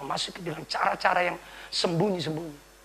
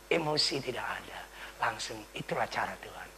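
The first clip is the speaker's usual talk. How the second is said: soft whisper, lower; gentle whisper; 7 semitones lower, 10 dB quieter than usual. Shown as a demonstration. Preacher showing how gently the Lord speaks, whispered. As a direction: breathe it gently. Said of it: "Dengan lembut Tuhan ngomong".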